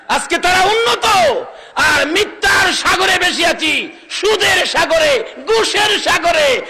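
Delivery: high, loud shouted rebuke; full-force preaching. Real, not acted. Preacher rebuking Muslims for seas of lies, shouted sermon. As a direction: ring out each phrase over the crowd.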